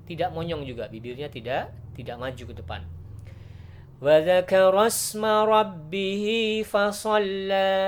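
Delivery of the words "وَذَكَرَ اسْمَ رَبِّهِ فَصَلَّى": chanted tajwid recitation, high and loud; Quran recitation. Shown as a demonstration. Teacher chants the verse for students in recitation style. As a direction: recite the verse in full melodic tartil.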